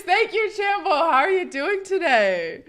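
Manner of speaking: high, loud sing-out greeting; effusive thanks. Real, not acted. Streamer thanking a subscriber, effusive. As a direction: gush at a supporter, stretching the question.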